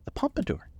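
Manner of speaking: high, playful Daisy voice; Daisy teasing. A narrator's reading. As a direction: tease him about the hairdo.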